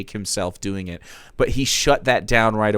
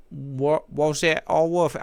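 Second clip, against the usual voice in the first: failed Australian try; 5 semitones higher, 3 dB quieter than usual. Shown as a demonstration. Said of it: "I can't, I can't do a fucking".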